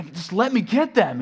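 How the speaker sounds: loud, high, slow pleading yell; angry yell. Retold or imitated. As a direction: drag it out in anger. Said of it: "I'm yelling at this one".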